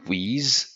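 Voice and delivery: WITH said slowly as "wiz"; buzzed z ending on WITH; French-learner "wiz" for WITH; French mispronunciation. Shown as a demonstration. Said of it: "so with is with".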